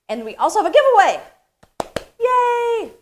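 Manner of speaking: high, loud giveaway cheer; excited announcement. Real, not acted. Host announcing a giveaway to viewers, excited. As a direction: build to a high-pitched "yay".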